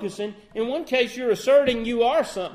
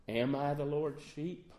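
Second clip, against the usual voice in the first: timid; 4 semitones lower, 7 dB quieter than usual. Shown as a demonstration. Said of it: "That's kind of timid".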